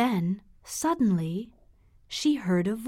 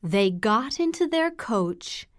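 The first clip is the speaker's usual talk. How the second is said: usual speaking voice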